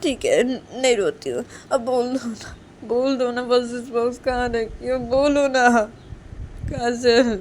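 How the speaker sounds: high, loud whine through tears; acted weepy insistence; tearful pleading. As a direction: coax her while wiping away tears. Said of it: "आंखों से आंसू को साफ करते हुए".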